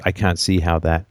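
usual speaking voice

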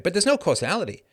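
ordinary talk

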